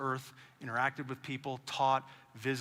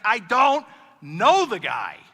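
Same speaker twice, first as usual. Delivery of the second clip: louder, slow, exasperated retort; frustrated snap; 5 semitones higher, 10 dB louder, much slower than usual. Retold or imitated. Peter denying Jesus a third time, slow angry snap. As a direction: snap it back, fed up, word by word. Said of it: "he gets frustrated and he snaps back".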